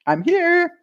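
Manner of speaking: high, playful call for a pretend gadget; voicing the waving hand. The keychain hand tells her where the car is, high and playful. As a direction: sing out like a cheery gadget.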